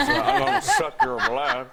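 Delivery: talking around fake fangs; mock lisp through a retainer; vampire teeth lisp. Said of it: "it's not very cool when you're talking like that".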